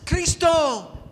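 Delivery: high, loud drawn-out call; beggar shouting. Acted. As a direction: call the name out at full voice. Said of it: "Y una vez más".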